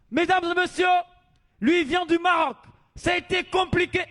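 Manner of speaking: loud, high stage introduction; announcer voice. Acted. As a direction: hype the crowd for the act. MC announcing to crowd.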